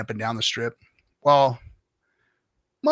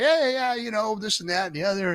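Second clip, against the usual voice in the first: higher, breezy dude voice sliding back down; casual dude chatter; 8 semitones higher than usual. Retold or imitated. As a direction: start high and relaxed, then let it settle. Naked guy chatting casually.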